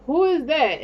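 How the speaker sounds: high, loud "who is that" in sudden wonder; retold surprise. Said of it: "I'm like".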